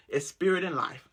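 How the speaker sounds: ordinary talk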